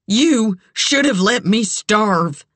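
high, slow, pained accusation; anguished. A narrator's reading. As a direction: draw it out in bitter anguish. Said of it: "felt a bright anguish".

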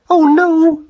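high, loud lament; wailing. A narrator's reading. Mother seeing her son lying still, high loud wail. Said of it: "she wailed".

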